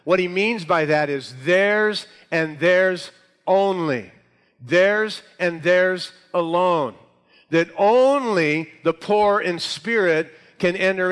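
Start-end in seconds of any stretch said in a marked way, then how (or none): 1.4-7.0 each "theirs" slow, raised and weighted; emphatic THEIRS. Shown as a demonstration. Preacher spells out who gets the kingdom, stressing each "theirs" slowly. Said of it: "It's emphatic". 7.5-11.1 "only" stretched, high and loud; emphatic ONLY. Shown as a demonstration. Preacher insists on who can enter, hitting "only" hard. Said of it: "It's emphatic".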